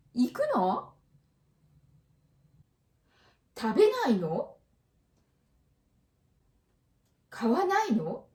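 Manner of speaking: each question ends on a rising の; rising question intonation. Shown as a demonstration. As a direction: ask each one with surprise, pitch climbing at the end. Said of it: "Rising intonation".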